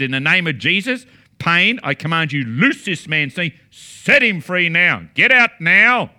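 builds from firm to a loud command at the end; commanding healing prayer. Shown as a demonstration. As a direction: order the pain out, rising to full force. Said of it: "I prayed just like this".

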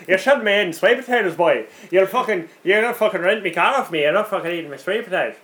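sweary mock Irish rant; attempted Irish accent. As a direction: rant in a hammed-up Irish brogue. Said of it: "your attempted Irish accent".

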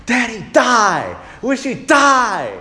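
put-on high, slow childish wail; child's curse. Son cursing his father, slow high child's wail. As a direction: drag it out like a furious little boy. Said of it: "the most extreme thing I've ever heard him say".

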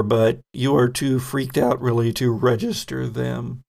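ordinary talk

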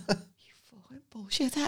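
whispered sneer through a laugh; whispered jibe. Retold or imitated. Mate whispering an insult at wine tasting, whispered jibe. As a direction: hiss it sideways at your friend. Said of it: "And my mate's going".

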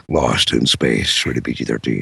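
very low, slow trailer announcer; deep booming movie trailer read; Peter Cullen. Retold or imitated. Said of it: "just to hear him say".

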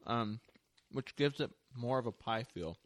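talking with mouth full; chewing while speaking. Real, not acted. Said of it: "I am so talking with my mouth full right now".

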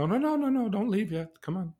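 high, loud no no no; startled protest. Retold or imitated. Therapist stopping the client leaving, high startled protest. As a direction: stop him leaving with a sudden high cry. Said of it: "I said".

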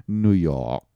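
NEW YORK said low and drawn-in, in an affected way; affected pronunciation. Pretend. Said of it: "why did you say it like that".

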